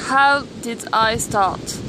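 ordinary talk

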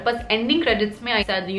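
normal talking voice

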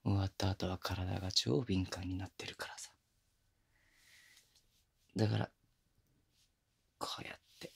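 soft intimate whisper, teasing. Acted. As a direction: murmur each word into her ear.

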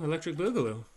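plain reading voice

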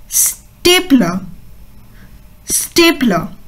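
स्टेपलर said slowly with the a as ए; slow model word. Shown as a demonstration. Teacher slowly models स्टेपलर for children. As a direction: draw out स्टेपलर, e sound first. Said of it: "तो उसको हम कहते हैं".

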